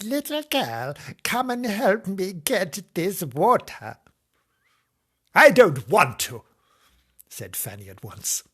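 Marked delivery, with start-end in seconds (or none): 0.0-4.0 high, slow crone calling; drawn-out, pitched-up old lady; old woman voice. Acted. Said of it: "this is my old woman voice". 5.3-6.4 put-on loud, higher childish refusal; bratty raised voice; defiant little girl. A narrator's reading. Fanny refuses the old woman.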